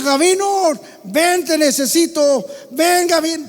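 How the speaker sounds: high, loud urgent call for help; reenacted call; urgent shout. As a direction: shout for help across the yard. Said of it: "un grito le grité".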